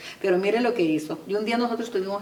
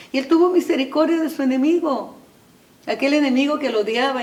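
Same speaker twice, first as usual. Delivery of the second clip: much higher and louder, proclaiming; preaching shout; 7 semitones higher, 7 dB louder than usual. Real, not acted. Preacher to congregation on the Samaritan's mercy, full-voiced shout. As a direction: cry it out to the congregation.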